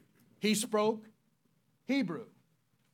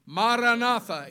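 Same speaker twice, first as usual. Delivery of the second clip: ordinary delivery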